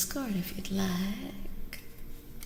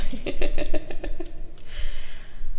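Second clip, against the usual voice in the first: breathy, voiceless laugh; 10 dB louder than usual. Real, not acted. Reader amused by nailing the reading, breathy laugh. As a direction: burst of airy giggling. Laughs at own blunt remark.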